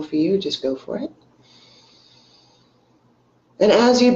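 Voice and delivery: unhurried phrases with long pauses between; guided meditation. Real, not acted. Meditation teacher leading online viewers into belly breathing, guided meditation. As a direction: ease them into breathing, leaving space after each instruction.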